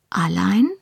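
hopeful, rising question; tentatively eager little question. A narrator's reading. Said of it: "fragte Hamish hoffnungsvoll".

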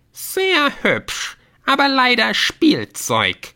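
very high, drawn-out mock praise; sneering gnome. A narrator's reading. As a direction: belittle it in a squeaky gnome voice. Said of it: "lästerte der Gnom". (lästernder Gnom mit sehr hoher Stimme)

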